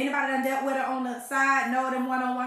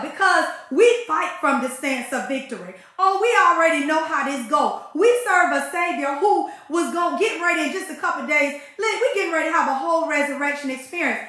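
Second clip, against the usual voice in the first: louder, driving preacher's cadence; preaching testimony; 5 semitones higher, 8 dB louder than usual. Real, not acted. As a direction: preach the victory to the congregation. Preaching testimony on Good Friday.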